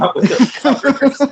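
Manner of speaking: high, breaking into laughter; laughing through the words; laughing while talking. Real, not acted. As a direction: crack up as you finish the line. Cracking up at own joke.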